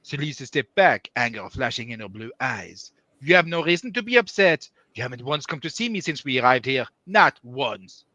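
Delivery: mock French accent with a touch of anger; French-accented dramatic reading, mildly angry. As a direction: read it as an indignant French character. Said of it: "You know, angry French".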